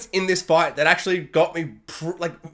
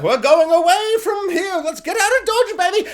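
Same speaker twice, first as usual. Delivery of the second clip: gleeful escapee; 11 semitones higher, 3 dB louder than usual. Retold or imitated. Voicing ship escapees gleefully.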